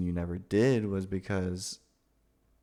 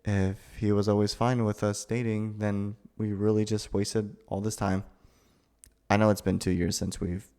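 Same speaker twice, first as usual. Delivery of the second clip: ordinary talk, nothing marked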